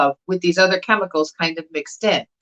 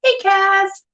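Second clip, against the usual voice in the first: high, drawn-out sing-song hello; calling a greeting; 11 semitones higher than usual. Real, not acted. Host greeting Cass as they join, high sing-song. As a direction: greet them on a high held note. Greeting someone joining the stream.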